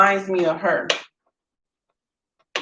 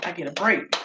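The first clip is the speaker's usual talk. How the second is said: much softer, half under the breath; relieved hush; 10 dB quieter than usual. Retold or imitated. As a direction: sneak the relief so grandma won't hear. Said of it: "And I'm like".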